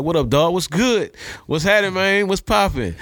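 high, loose street greeting; casual home voice. Retold or imitated. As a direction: greet your boys back home, laid back and high. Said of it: "I had that, that voice".

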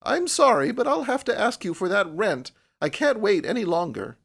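insistent landlady. A narrator's reading. Landlady to June, asking for overdue rent, insistent. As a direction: press for the money in a high voice.

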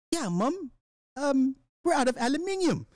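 high put-on British voice; teasing falsetto British impression; mock British accent. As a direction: play a polite Brit phoning mum, pitched high. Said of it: "These motherfuckers like".

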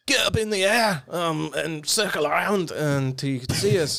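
high, slow put-on Scottish accent. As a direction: call it out in an exaggerated Scottish accent. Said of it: "Say your Scottish accent".

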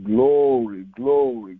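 ordinary talk

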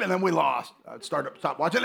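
ordinary talk, nothing marked

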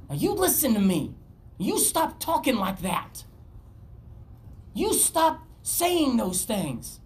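slow stern scolding; stern rebuke. Retold or imitated. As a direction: scold him like a worried friend. Peter rebuking Jesus, acted.

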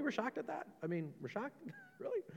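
mock incredulous. Preacher mocking shock.

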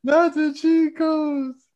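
slow teasing repeat, climbing very high; mocking echo. Friend ribbing another over his boast, mocking. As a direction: draw it out in mock disbelief.

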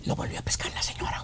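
conspiratorial whisper; hushed gossip. Acted. Telling friends the wife caught him, whispered. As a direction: drop to a whisper for the scandal.

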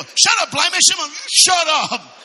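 high, loud mock shout, last words drawn out; acted angry shout. Preacher acting a crowd silencing a blind man, mock shout. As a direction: shout him down, hammed-up.

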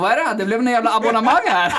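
dad balking at paying for every goal, mock outrage; mock exasperated dad. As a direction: play the fed-up father, half laughing. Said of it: "Han bara".

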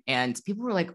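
ordinary delivery

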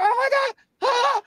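retold terrified exclamation; shocked shout. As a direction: blurt it out scared. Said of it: "And I was like".

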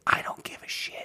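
whispered aside; whispering. Real, not acted. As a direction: drop to a whisper, dismissive. Dismissing fantasy talk.

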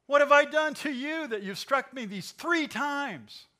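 high, loud squeal of an aggrieved donkey; pleading donkey. Acted. Preacher voicing the donkey's loud high protest to Balaam. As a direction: give the beaten donkey a shrill indignant cry.

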